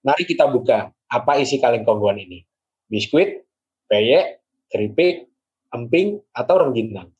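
measured poetic reading; poem reading. Acted. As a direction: read the poem aloud, lingering. Reads poem aloud.

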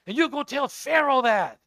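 loud and high, driving the order home; a booming send-off; commanding God. Acted. God ordering Moses to face Pharaoh, loud and high.

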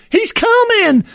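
put-on high, excited old prospector cry. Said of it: "he goes".

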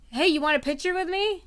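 mimicking a friend's brazen offer; imitated bold offer. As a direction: call it out high, like offering a favour.

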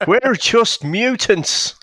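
put-on high, loud, drawn-out Irish accent; Michael Fassbender's slipping Irish accent. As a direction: do a hammed-up Fassbender turning suddenly Irish. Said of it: "If he moves into an Irish accent".

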